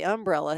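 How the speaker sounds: "umbrella" as UM-brella; front-stressed Southern "umbrella". Shown as a demonstration. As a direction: punch UM in "umbrella". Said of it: "it does sound very Southern".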